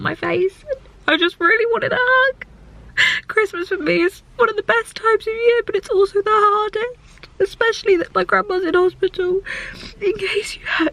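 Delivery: high, emotional voice near tears; real tearful upset. Real, not acted. Tearful about Christmas and grandmother.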